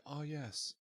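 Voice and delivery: soft, low, mock-wistful musing; hushed, dreamy reminiscence, acted out; wistful reminiscing. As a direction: murmur it as if lost in fond memories. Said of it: "wistfully being like".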